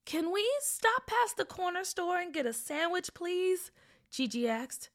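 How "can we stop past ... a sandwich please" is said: put-on higher, lightly pleading girl; pleading child. Girl asking dad for a sandwich, pleading. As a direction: ask sweetly in a little girl's voice.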